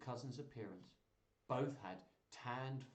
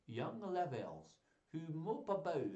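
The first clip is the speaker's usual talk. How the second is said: put-on French accent; 4 semitones higher than usual. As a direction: recite grandly in a shaky attempt at French accent. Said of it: "shall I try a French accent?".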